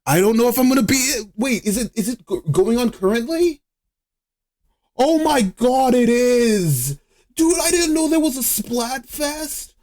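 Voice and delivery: high-pitched, startled disbelief; alarmed surprise. Real, not acted. Streamer finding out Splatfest is on now, shocked. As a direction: blurt it out way up high, stunned by the news.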